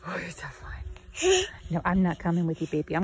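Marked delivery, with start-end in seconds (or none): 0.1-1.4 whispered pet talk. Real, not acted. Talking to dog in puddle.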